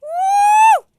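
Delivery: high loud woo; high loud cheer; excited whoop. Real, not acted. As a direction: whoop for joy.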